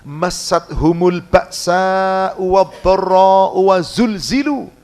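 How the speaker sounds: slow chanted Arabic recitation; Quran recitation. Acted. Preacher reciting the verse to the congregation, slow chant. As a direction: intone the verse slowly like tilawah.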